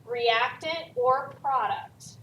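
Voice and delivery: normal talking voice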